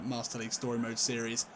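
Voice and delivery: ordinary delivery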